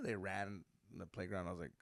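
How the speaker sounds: usual speaking voice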